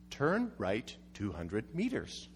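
low, slow, soft robotic navigation voice; flat-toned mock GPS announcement. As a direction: read it out like a sat-nav. Reading out the GPS instruction.

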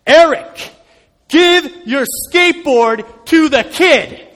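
the voice in his head pressing harder. Retold or imitated. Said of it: "this time louder".

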